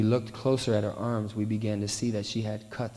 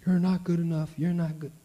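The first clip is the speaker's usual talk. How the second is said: higher mocking inner voice that runs you down; put-down voice of self-doubt, pitched up; inner critic voice; 7 semitones higher than usual. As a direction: sneer it like the voice in your head.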